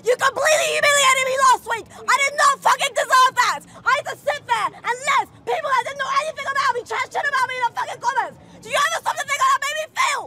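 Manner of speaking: tearful yelling at the host; furious shouting. Acted. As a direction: scream it like you were humiliated. Staged furious rant at host.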